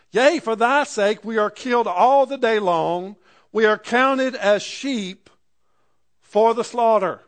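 a preacher declaiming the verse; proclaimed. Acted. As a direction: ring out "counted" slow and loud. Scripture climax read aloud.

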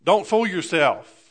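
rising pulpit fervour; preaching. Acted. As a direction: preach it out to the congregation. Preaching builds up.

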